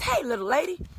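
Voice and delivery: lightly voiced jolly tow man; jolly mechanic. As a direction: greet her like a merry Santa. Jolly Santa-like mechanic greeting.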